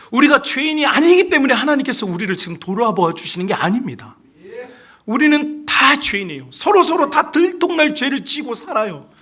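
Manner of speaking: high, loud, impassioned preaching; full-force preaching. Real, not acted. Preacher tells congregation all are sinners, preaching at full force.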